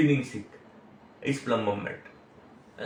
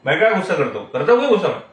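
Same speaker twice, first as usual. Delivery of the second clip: louder, irritated protest about not being angry; mock angry denial; 6 dB louder, much faster than usual. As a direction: snap back at the wife in a mild mock temper. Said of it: "बोलते time भी गुस्सा है". (पत्नी से चिढ़कर, हल्का दिखावटी गुस्सा)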